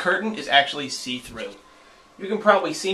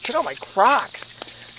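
showing off; 8 semitones higher, much slower than usual. Real, not acted. Showing off shoes.

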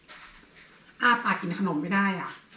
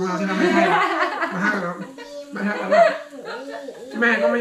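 laughing; 6 semitones higher, 5 dB louder than usual. Real, not acted. Laughing while teasing.

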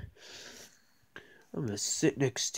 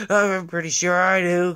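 ordinary talk

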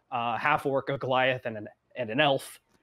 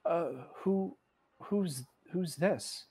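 soft, hesitant, slow question; halting and hushed, pitched up; Arthur; 4 semitones higher, 7 dB quieter, much slower than usual. Acted. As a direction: ask gently, feeling for the words. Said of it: "Arthur kind of looks down and goes".

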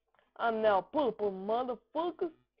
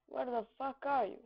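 normal talking voice

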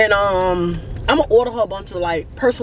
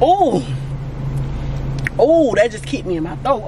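sharp ooh. Real, not acted. Soda burns her throat.

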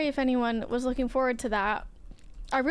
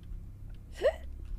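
sudden breathless gasp; a startled intake of breath; sharp gasp; 8 dB quieter than usual. Real, not acted.

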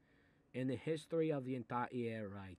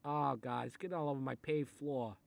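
ordinary delivery

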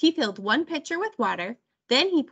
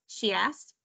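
usual speaking voice

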